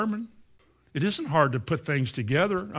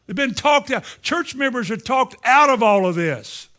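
raised, loud sermon delivery; impassioned preaching; 5 semitones higher, 6 dB louder than usual. Real, not acted. Preacher telling the congregation church members are talked out of this, impassioned. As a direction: drive the point home at full preaching force.

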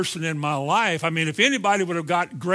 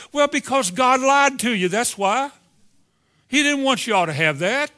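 mock-serious sarcasm, pitched up and a bit louder; facetious; 6 semitones higher, 3 dB louder than usual. Preacher to congregation, mocking the doubters' logic, sarcastic. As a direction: sell the absurd claim with a straight face. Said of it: "You know I'm being facetious".

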